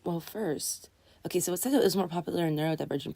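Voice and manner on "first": usual speaking voice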